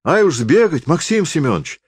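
high, eager cry; a peasant wife startled into eagerness; flustered woman. A narrator's reading. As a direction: jump at the errand. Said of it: "всполохнулась баба".

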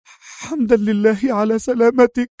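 high, relieved woman's voice; laughing through tears. A narrator's reading. Woman holds his hand, high and tearful-glad. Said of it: "ضحكت على الرغم من دموعها".

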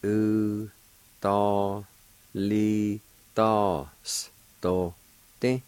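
"U, to, li, tos, to, ti" stretched out, one syllable at a time. Shown as a demonstration. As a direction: sound out "U, to, li, tos, to, ti" slowly with pauses. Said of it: "is pronounced like this".

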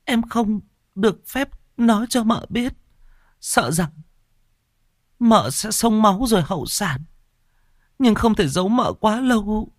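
high-voiced, tearful apology; sobbing maid. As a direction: plead and sob at once. Maid tells the bad news.

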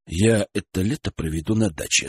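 plain reading voice

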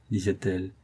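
ordinary delivery

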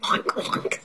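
soft, voiceless imitation oinks; pig oinks. A narrator's reading. Storyteller voices Pig waking up, soft imitation oinks. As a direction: imitate a pig under the breath. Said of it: "said Pig".

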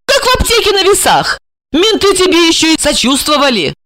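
high-pitched, excited female character voice; bubbly high voice, teasing and boastful; playful, cocky woman. Acted. Plays Regina, playful and boastful.

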